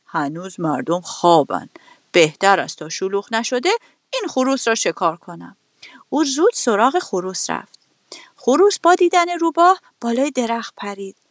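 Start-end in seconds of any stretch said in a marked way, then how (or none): 0.1-5.6 lower, scheming fox voice; a hungry fox plotting under his breath; lightly voiced cunning fox; sly fox muttering to himself. A narrator's reading. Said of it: "اون با خودش گفت".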